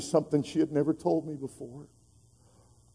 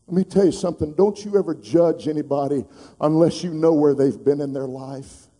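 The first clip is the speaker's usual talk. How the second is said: ordinary talk, nothing marked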